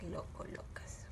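softly whispered instruction. Real, not acted. Teacher whispering a step to the children. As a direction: breathe it without voice.

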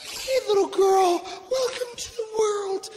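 high-pitched new mother cooing; mock tearful wife's high coo; imitating tearful wife. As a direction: greet a newborn in a put-on high voice. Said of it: "My wife's crying".

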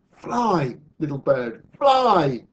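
loud, high urging; insistent storybook cry; urgent command. Acted. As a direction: call out to send it soaring, bigger on the last word. Sue urges the bird once more.

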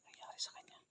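soft, tense hushed whisper; whispering while hiding. Real, not acted. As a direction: whisper so no one hears. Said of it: "Shh, huwag kayong maingay".

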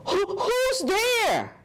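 high little mouse calling out; scared mouse cry. Acted. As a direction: squeak out the question. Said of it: "Mouse cries".

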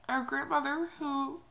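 normal talking voice

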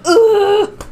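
loud high exasperated groan, laughing; frustrated groan. Retold or imitated. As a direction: let out an exasperated ugh. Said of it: "and I'm just like".

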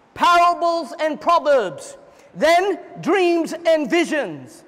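"Parables" and "then" dragged out slowly; slow emphatic repeat. Shown as a demonstration. Preacher driving home the point to the church, slow stress. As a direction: spell it out slowly, word by word. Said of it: "repeated slowly".

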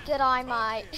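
loud mock-Australian greeting; hammed-up Aussie "g'day, mate"; Australian accent. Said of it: "I got a mighty Australian accent here".

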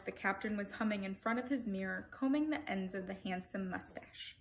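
ordinary delivery

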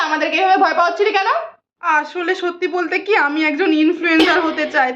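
ordinary talk

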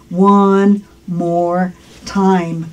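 word by word, drawn out; very slow model phrase. Shown as a demonstration. Teacher modelling English for learners to repeat, very slow. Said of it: "Una vez más".

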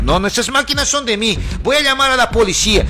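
voz alta y aguda, exigiendo con furia; grito enfadado. Retold or imitated. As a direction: reclama sus cosas gritando, cada vez más agudo. Hombre exige sus cosas, amenaza. (el hombre le grita que todo es suyo, furioso)

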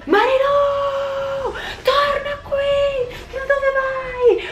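high, slow, wailing cry after her sister; crying shout. Acted. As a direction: sob it out and drag every word, calling her back. Twin abandoned, calling after sister.

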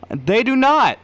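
real indignation; indignant denial. Real, not acted. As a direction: insist hotly.

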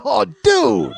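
loud, drawn-out groaning disbelief; exasperated cry. Retold or imitated. Man hearing the bars are tiny, loudly exasperated. Reacts to tiny Kit Kats.

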